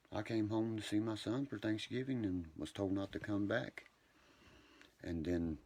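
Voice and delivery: usual speaking voice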